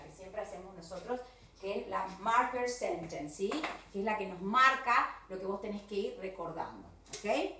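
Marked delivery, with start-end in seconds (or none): none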